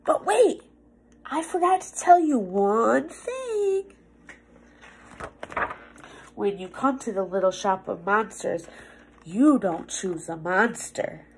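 usual speaking voice

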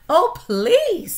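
very loud, high "Oh, please"; exasperated wife. Acted.

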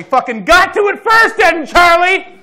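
loud, high gloating yell; triumphant shout. Real, not acted. Host taunting rival venue owners, loud and high. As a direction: crow over the rivals.

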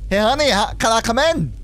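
put-on Scottish suitor; Scottish accent. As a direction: play a wheedling Scottish ex at the door. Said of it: "All right, I'll do Scottish".

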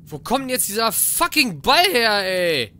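loud, high furious yelling; angry shout. Real, not acted. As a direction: rage at the ball he can't knock away. Ball attacks, he rages. (wütend, laut geschrien)

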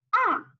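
high-starting 啊 that falls; fourth tone. Shown as a demonstration. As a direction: drop 啊 downhill. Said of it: "我们再来一起说一遍".

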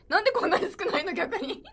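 laughing disbelief at the poll. Real, not acted. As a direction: giggle the question out.

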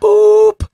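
a co-host mimics the phone picking up; mock phone boop. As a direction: chirp it like a phone line connecting. Imitating the phone connecting.

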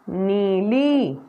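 very slow, stretched word; slow blending. Shown as a demonstration. Teacher blends मटकी slowly for children, stretched. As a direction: draw each sound out for the class.

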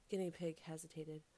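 usual speaking voice

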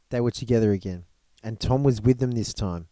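ordinary delivery

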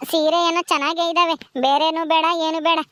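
usual speaking voice

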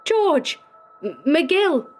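high, stammering cry of horror; terrified cry. Acted. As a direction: stammer the name out in terror. Friend realises the killer is near.

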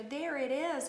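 loud and high call of sighting; shout. A narrator's reading. Man in the crowd spotting the ship, shouting. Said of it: "a man shouts".

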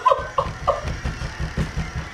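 squealing fearful laugh; high airy giggle; high breathy laugh. Real, not acted. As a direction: laugh out of panic. Scare on screen.

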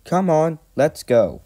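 high, eager call; whistling engine. A narrator's reading. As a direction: urge them on in a piping engine voice. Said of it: "he whistled".

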